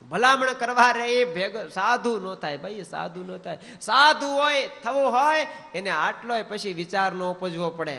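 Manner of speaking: high, loud sermon point; preaching. Acted. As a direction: hammer home the lesson. Preaching at full force.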